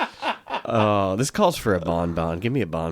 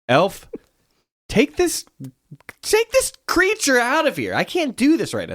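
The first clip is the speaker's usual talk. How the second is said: high, louder hammed-up order to the elf; exasperated Santa; 3 dB louder than usual. Fed-up Santa orders his elf, high hammed-up yell. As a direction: shoo the kid away, fed up and shrill.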